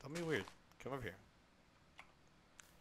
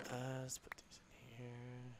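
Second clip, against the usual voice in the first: whisper; 12 dB quieter, slower than usual. Real, not acted. Placing hives, whispering aside.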